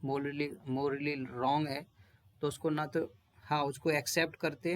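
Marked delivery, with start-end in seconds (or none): 0.0-1.9 morally repeated slowly and deliberately; slow teacherly stress; slow emphasis. Shown as a demonstration. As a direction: drive home morally wrong slowly. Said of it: "जो moral".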